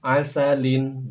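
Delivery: "Asalin" slow and loud; model Arabic reading. Shown as a demonstration. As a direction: stretch out "Asalin". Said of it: "Berikutnya silakan". ("Asalin" dibaca pelan dan lantang)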